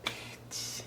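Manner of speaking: breathless whispered curse; a muttered whisper of contempt; whispered insult. Real, not acted. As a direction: hiss it under your breath.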